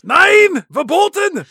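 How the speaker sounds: put-on loud, high barked German-accented warning; German guard shout. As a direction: yell it like a border guard ordering them back. Guards warn off the helicopters.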